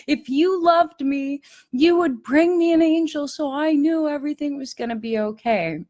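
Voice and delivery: put-on high, whiny begging like a hurt child; childlike pleading. Crying alone at night, begging God to send an angel, high and whiny. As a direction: plead up high as if throwing a little tantrum.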